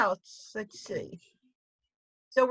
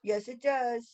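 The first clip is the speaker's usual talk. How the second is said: softer repeat; 7 dB quieter, slower than usual. Real, not acted. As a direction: drop it back down quietly. Repeated softly.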